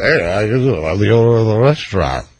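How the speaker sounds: mock a creep hitting on her; creep's pickup line. Host mocks a creep chatting up his wife, lightly sleazy. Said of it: "Some bum who said".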